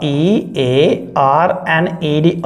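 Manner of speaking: teacher dictating letters for students to write; spelling it out slowly. Shown as a demonstration. Teacher spells the word for class, letter by letter, slowly. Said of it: "क्या बोलेंगे यहाँ पे?".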